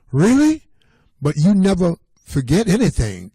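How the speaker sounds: put-on amazed child's disbelief; surprised. Thomas doubts Papa ever forgets anything, a surprised high question. Boy amazed at grandfather's claim.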